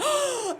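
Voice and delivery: high mock gasp; big intake of mock surprise; imitated shocked gasp. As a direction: gasp like a stunned audience. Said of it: "And they was like".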